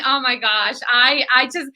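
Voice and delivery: ordinary talk, nothing marked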